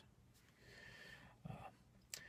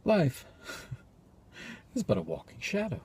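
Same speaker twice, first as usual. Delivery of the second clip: bleak soliloquy; 10 semitones higher, 11 dB louder than usual. Acted. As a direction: deliver it as a halting, despairing soliloquy. Performs a despairing soliloquy.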